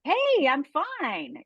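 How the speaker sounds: high, slow forced brightness; voicing the outside self who hides the pain; fake cheerful. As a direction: put on a sunny mask. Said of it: "On the outside".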